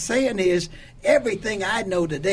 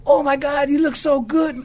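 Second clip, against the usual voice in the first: high, loud admiring exclamation; retold amazement; 9 semitones higher, 5 dB louder than usual. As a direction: re-enact being astonished at a friend's appearance. Amazed on seeing his saved friend.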